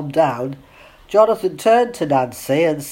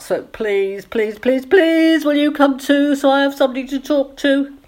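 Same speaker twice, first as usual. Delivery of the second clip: high-pitched begging, climbing with each please; desperate pleading; 10 semitones higher than usual. A narrator's reading. Sally begs her friend to come to dinner, desperate pleading. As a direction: plead desperately, voice rising higher and higher. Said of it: "She sounded so desperate".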